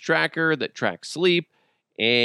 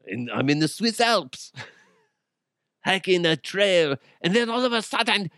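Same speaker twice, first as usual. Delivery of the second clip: put-on higher, makeshift Swiss accent; attempted Swiss accent; 5 semitones higher than usual. Telling a nightmare in the Swiss Alps, mock Swiss accent. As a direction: dream storyteller in a dubious Swiss accent. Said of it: "Swiss accent sounds like right now".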